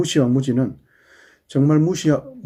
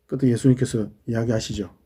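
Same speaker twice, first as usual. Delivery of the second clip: usual speaking voice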